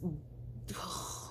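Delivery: long, soft huff of breath; exasperated sigh. Real, not acted. Her recalling the awful prank, exasperated sigh. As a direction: sigh in place of words. Lost for words about prank.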